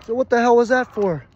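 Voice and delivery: high, loud mock outrage; indignant protest. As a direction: protest the slap at high pitch. Protests being slapped.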